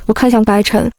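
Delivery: usual speaking voice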